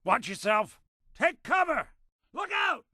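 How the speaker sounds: high-pitched combat warning yell; urgent warning shout. Acted. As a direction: shout it like danger is incoming.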